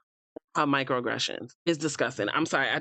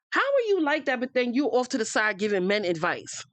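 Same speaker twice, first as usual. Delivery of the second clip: ordinary talk, nothing marked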